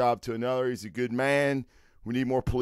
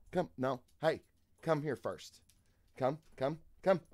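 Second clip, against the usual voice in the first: soft coaxing pet voice, much quieter; 9 dB quieter, faster than usual. Real, not acted. Owner softly coaxes his dog to greet viewers. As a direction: coax the dog gently.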